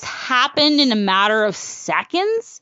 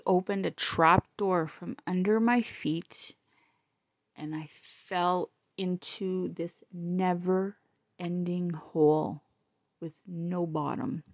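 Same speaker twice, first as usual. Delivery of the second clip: hollow and low; 8 semitones lower, 8 dB quieter, slower than usual. Real, not acted. Recalling cancer news.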